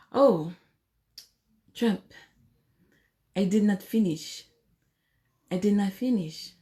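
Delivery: playful mock complaint, a touch hushed; Melania impression. Wife complains to Trump in the bedroom, soft mock Melania voice. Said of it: "she might say something like".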